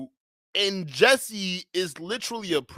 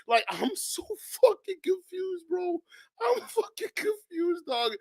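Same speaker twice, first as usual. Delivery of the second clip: much higher, softer, crying-laugh whine; squeaky confusion; 9 semitones higher, 5 dB quieter than usual. Real, not acted. Streamer whining to chat about being confused. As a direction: whine it out in disbelief.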